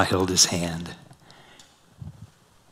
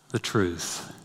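hushed; 2 semitones lower, 9 dB quieter than usual. Acted. Tender pause on Jesus' words.

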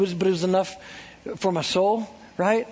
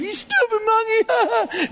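high, slow mock taunt; sing-song jeer at the chimps; taunting kid; 12 semitones higher, slower than usual. Kid at zoo taunting monkeys, high mocking jeer.

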